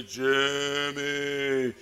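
low, long-drawn mystery voice; eerie name-calling. Retold or imitated. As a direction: call the name out slowly from a hiding place. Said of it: "and yell his name".

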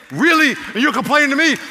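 loud, high, incredulous rebuke; impassioned scolding as God; passionate yelling. Acted. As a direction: raise the voice in indignant reproach. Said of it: "I'm saying this out of my passion".

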